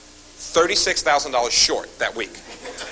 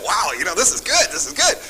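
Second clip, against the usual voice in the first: a lightly replayed thrill at the first deal; excited; 2 semitones higher than usual. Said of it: "getting excited".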